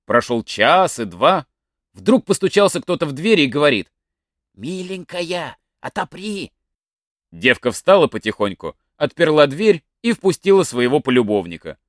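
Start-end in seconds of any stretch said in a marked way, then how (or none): 4.5-6.5 soft, slow, hushed call through the door. Acted. Lover at night asks the girl to unlock, hushed and slow. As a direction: coax her to open up quietly.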